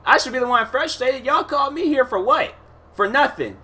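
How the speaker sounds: retold complaint, high and indignant. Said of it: "I'm like".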